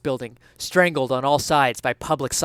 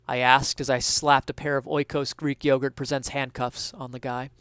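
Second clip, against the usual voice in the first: ordinary delivery, nothing marked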